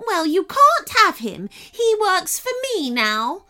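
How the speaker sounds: high, loud possessive refusal; bossy princess. A narrator's reading. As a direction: lay claim like a spoilt royal. Princess refuses, glaring at the girl.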